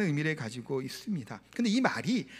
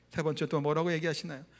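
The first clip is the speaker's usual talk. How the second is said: normal talking voice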